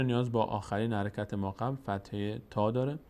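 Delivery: normal talking voice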